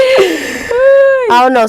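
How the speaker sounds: high, slow words through laughter; laughing hard. Real, not acted. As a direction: laugh until the words break.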